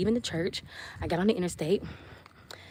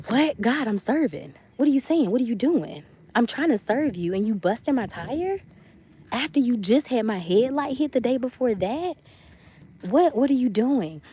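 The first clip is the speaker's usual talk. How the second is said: higher, incredulous questioning with swooping pitch; mock indignant protest; 5 semitones higher, 3 dB louder than usual. Questioning God over a busted tire, mock indignant and higher. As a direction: plead and protest, hammed-up disbelief.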